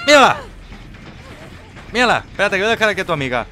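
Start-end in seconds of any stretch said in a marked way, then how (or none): 0.1-2.2 loud, high, quick cry; excited call-out. Real, not acted. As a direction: call it out on spotting your target. Spots a player, calls out.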